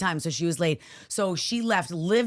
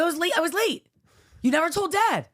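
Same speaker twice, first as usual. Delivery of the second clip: put-on high, loud upset-kid voice; playing the daughter, high and wronged; 13 semitones higher, 4 dB louder than usual.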